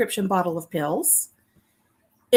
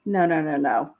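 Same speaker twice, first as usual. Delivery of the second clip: usual speaking voice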